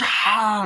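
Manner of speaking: loud, high acted yell; sudden hammed-up war cry; attacker's yell. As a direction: burst out like a charging attacker.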